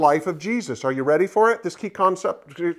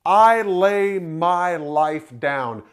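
slow, solemn declaration; 3 dB louder, much slower than usual. Retold or imitated. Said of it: "he said".

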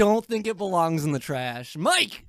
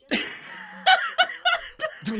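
high laughter. Real, not acted. A guest interrupts the recording.

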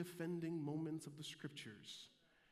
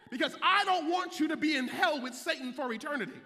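loud, rising preacher's call; shouting the congregation into the gospel; preaching at full force; 10 semitones higher, 11 dB louder than usual. Acted. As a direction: whoop it up like a Sunday sermon climax.

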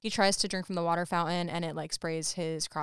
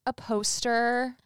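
mocking Bonnie; 5 semitones higher, 4 dB louder, slower than usual. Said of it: "Bonnie would probably be like".

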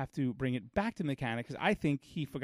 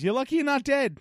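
high, loud mock warning; imitated mechanic; 12 semitones higher, 7 dB louder than usual. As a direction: exclaim it as the incredulous mechanic. Retells the mechanic's alarming warning.

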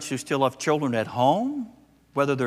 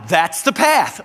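put-on matter-of-fact toddler; 6 semitones higher, 7 dB louder, slower than usual. Granddaughter answers grandpa.

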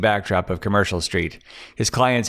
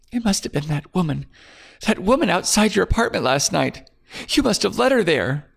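higher, anxious woman blaming; fearful accusation; 11 semitones higher than usual. Acted. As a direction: point the finger in a scared voice. Claire voiced, accusing.

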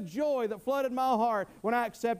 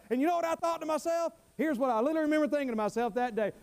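normal talking voice

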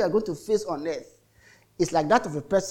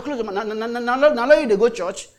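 retold scolding; 5 semitones higher than usual. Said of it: "I said".

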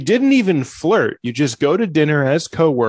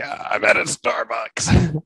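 dazed deer voice; 7 semitones higher, slower than usual. Pretend. Deer dazed under the rock.

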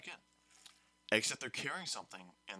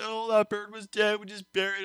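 much higher, louder whine; mock sad students; 11 semitones higher, 9 dB louder than usual. Students lamenting the bird, loud and high. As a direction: wail it like dim kids. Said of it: "They were just like".

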